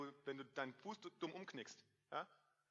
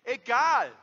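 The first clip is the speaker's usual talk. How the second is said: one sharp loud word, higher; loud dismissal; 9 semitones higher, 20 dB louder than usual. Real, not acted. Preacher to the congregation, loud dismissive shout. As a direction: shout it out, brushing it aside. Preacher brushes off self-doubt.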